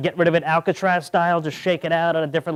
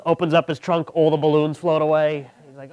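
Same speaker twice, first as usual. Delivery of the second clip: usual speaking voice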